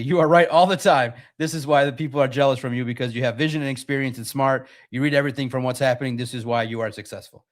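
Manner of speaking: ordinary talk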